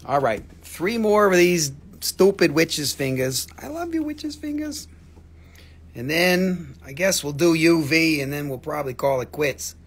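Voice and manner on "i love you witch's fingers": high cooing to the crystals. Real, not acted. Seller cooing over her witch's finger crystals, high and soft. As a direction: coo at the crystals like pets.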